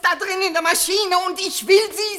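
desperate plea. Acted. Grieving mother insists to her husband, desperate loud plea. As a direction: insist through tears.

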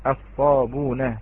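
الصابون said slowly without hamza, sabuna; correct reading. Shown as a demonstration. Teacher correcting a student's reading. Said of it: "لا، وإنما".

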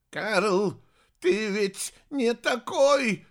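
high, loud, drawn-out plea; moaning.

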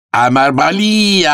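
high, loud, drawn-out yell; angry shout. Acted. Italian sailor grabs Arthur by the throat, shouting. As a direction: roar it out high and long. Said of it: "кричит".

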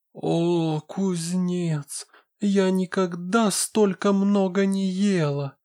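put-on high, contented overfed girl; she-wolf voice. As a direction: sigh it out, stuffed and happy. She-wolf captive speaks.

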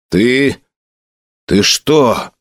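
halting, stunned outrage; understated indignant disbelief with a long pause; speechless indignation. A narrator's reading. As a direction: break off, too indignant to go on. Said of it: "от возмущения не мог вымолвить ни слова".

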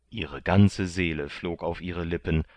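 usual speaking voice

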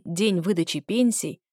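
ordinary delivery

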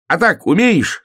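high, loud challenge; calling out. Acted. Hare shows off tricks to someone, calling out. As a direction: shout it high, showing off. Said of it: "кому-то кричал он".

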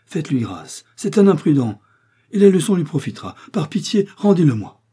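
high, loud, breaking plea for mercy; anguished outcry of a father before the committee; desperate pleading father. A narrator's reading. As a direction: beg for your son's life, near tears. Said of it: "par les pleurs de ce père".

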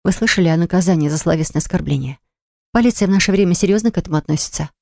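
usual speaking voice

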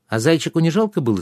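put-on high, quick child's question; curious child voice. A narrator's reading. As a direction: ask like a curious little boy. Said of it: "спрашивал Трикс".

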